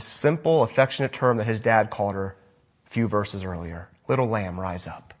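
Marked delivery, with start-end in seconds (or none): 4.1-5.1 much softer, lower, tender call; tender whisper-soft. Retold or imitated. Jesus at the bedside, tenderly waking the little girl, very soft. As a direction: coax her awake like a sleeping child.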